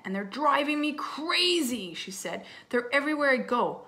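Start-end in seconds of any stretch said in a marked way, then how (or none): 0.1-1.9 exasperated complaint, high and drawn out. Acted. Little sister complaining about the visiting twins, exasperated. 2.7-3.8 loud, high exasperation; exasperated. Acted. Little sister moaning that twins are everywhere, exasperated.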